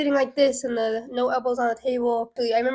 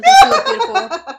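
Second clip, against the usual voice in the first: higher, louder through laughter; laughing; 12 semitones higher, 13 dB louder than usual. Real, not acted. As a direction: say it laughing loudly. Laughs while complimenting Grandma.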